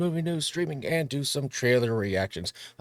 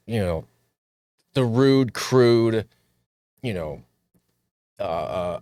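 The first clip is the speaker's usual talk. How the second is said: ordinary talk, nothing marked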